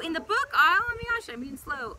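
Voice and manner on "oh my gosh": excited exclaim. Real, not acted. Excited find of something outdoors.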